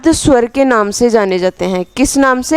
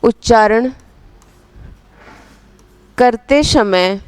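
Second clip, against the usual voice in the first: slow dictation, phrase by phrase with long pauses; measured words spaced out for note-taking. Real, not acted. Teacher dictating a definition for students to write, slow dictation.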